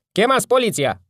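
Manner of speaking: woman at a window shrieking for the police; shrill woman's voice. A narrator's reading. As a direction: screech it like an alarmed woman. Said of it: "o voce ascuțită de femeie".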